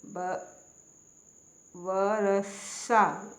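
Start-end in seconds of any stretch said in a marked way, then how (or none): 1.8-3.3 वर्षा drawn out slowly as "va-ra-sa"; wrong s sound. Shown as a demonstration. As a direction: split वर्षा and end on a plain s. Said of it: "ये गलत है".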